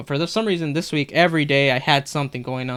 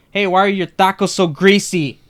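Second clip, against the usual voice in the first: loud, higher, rude shouted complaint; screaming grandma; 5 semitones higher, 6 dB louder than usual. Retold or imitated. Grandma yelling at server from a few feet away, loud shrill-free rude scream. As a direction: yell it across the counter like she owns the place. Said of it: "And she screams".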